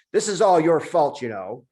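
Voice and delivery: loud, high accusation fading at the tag; angry blame. Acted. Julian blames the monkey, loud and angry. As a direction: point the finger hard.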